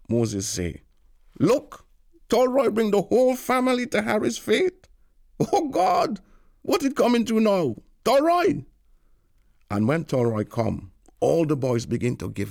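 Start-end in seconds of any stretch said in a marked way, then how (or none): 1.4-8.6 amused calling out. A narrator's reading. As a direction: call out in mock dismay. Moses mocking aloud.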